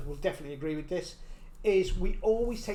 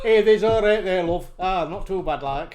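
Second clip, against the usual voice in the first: loud, hearty Yorkshire greeting; Yorkshire accent; 7 dB louder than usual. Shown as a demonstration. Asked for Yorkshire, greets a local, Yorkshire accent. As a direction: launch into broad Yorkshire.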